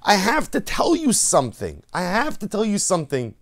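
high, loud call after someone. Acted. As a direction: shout after the running pauper. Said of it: "the Rebbe calls out to him".

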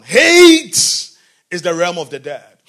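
preacher repeats Hate for note-taking; stress on Hate again. Shown as a demonstration. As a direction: punch Hate so they write it down. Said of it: "Write it down".

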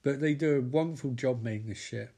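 ordinary talk

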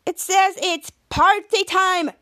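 high, slow mock Scottish; Scottish accent. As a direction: imitate an excited Scottish newscaster. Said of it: "Scottish Mary Gaberman said".

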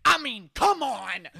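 loud, slow, high indignant sports-debate rant; Stephen A Smith. Acted. Friend plays Stephen A ranting on sports TV, loud and outraged. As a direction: blast it like a TV pundit outraged on air. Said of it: "I'm gonna go full Stephen A".

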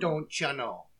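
low, soft mock Fargo accent. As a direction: try out a Fargo accent quietly. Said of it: "I just wanna do a Fargo accent now".

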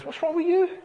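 high, scornful question; a wife needling her sulking husband; mock exasperated wife. Wife scolds sulking king, high and scornful.

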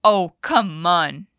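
slow, loud indignant come on. Shown as a demonstration. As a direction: sound fed up and outraged. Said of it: "com aquele tom de indignação". (indignado e arrastado)

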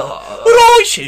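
loud, high, hammed-up Yorkshire greeting; Yorkshire accent. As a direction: bellow a Yorkshire hello to Sharon. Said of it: "That's a Yorkshire accent".

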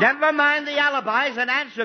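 high, loud order; stern snap. Acted. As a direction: cut the excuses. Father snaps at son.

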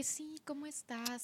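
hushed and slow; gentle calm greeting; soft zen voice. Retold or imitated. Zen mom greeting quietly.

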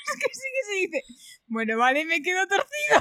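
high laughter through the words; cracking up while telling it; laughing hard. Real, not acted. Retelling dad's plane story, laughing high through it. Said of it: "Ay, por Dios".

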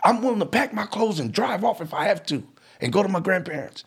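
high, insistent teenager, retold; determined upset son. Son at the door demanding his say, upset and insistent. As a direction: stand your ground in a high, urgent voice. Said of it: "he said".

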